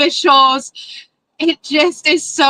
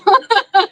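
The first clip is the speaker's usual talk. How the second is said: a quick self-conscious laugh, higher; short laugh; 6 semitones higher than usual. Real, not acted. Mayor laughing at her own emotion at the podium. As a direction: laugh it off after tearing up.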